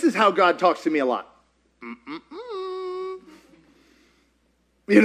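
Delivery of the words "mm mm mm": high, soft mm-mm with a laugh; high disapproving hum. Retold or imitated. God turning the preacher down with a high hum. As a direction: hum a playful no.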